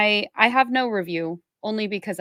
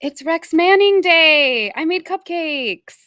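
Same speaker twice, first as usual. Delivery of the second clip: loud, high, slow and thrilled; gleeful slow proclamation; excited announcement; 8 semitones higher, 9 dB louder, slower than usual. Real, not acted. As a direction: burst out with the big news. Cohost announces the special day.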